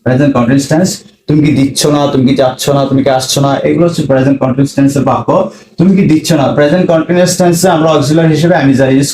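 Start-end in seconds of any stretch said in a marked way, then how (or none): none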